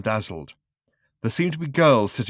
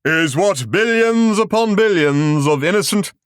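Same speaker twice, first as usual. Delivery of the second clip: booming, high grand showman voice; grand announcer; 8 semitones higher, 7 dB louder than usual. A narrator's reading. Film narrator booms at the audience, grand and loud. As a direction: proclaim it like a cosmic showman.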